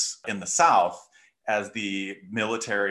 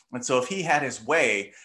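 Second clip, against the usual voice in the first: usual speaking voice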